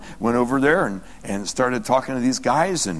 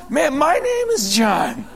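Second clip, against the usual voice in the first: high, drawn-out astonishment with a laugh; amazed discovery; 12 semitones higher, 3 dB louder, slower than usual. Retold or imitated. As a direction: marvel at the coincidence, stretching it out. Retelling his amazed discovery of namesake.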